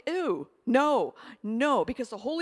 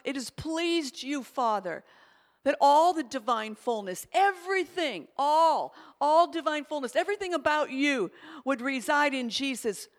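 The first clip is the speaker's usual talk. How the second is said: fervent prayer; 3 dB louder than usual. Acted. Paraphrased scripture as a prayer.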